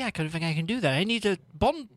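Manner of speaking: ordinary talk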